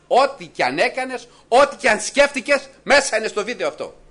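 high, loud warning from the pulpit; forceful preaching. Acted. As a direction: drive it home to the congregation. (δυνατό κήρυγμα, ψηλά και δυνατά)

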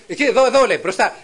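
loud, high, frantic pointing; panicked shout. Retold or imitated. Frightened wife yelling at her husband to watch ahead, loud and high. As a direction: shout to drag his eyes back ahead.

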